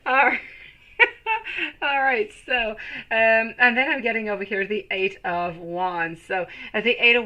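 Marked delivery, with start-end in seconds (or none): 1.0-1.8 high, short burst of laughter; real laugh. Real, not acted. Still amused by the viewer, laughing. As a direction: laugh it off quickly.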